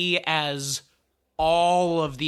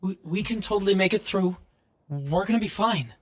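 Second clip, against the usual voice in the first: yellow version; 4 semitones higher than usual. Acted. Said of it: "as this yellow version speaks".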